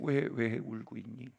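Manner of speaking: soft, slow tender asking; quiet caring question to a crying girl; gentle question. Retold or imitated. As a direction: ask her softly, slowly.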